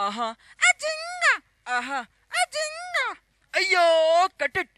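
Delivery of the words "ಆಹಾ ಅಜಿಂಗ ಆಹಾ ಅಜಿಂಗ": much higher breathy-free squeals; sing-squeal like a shy heroine; high actress voice. Acted. Actress shivering song.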